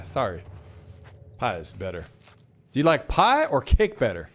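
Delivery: ordinary delivery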